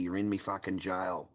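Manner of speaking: ordinary delivery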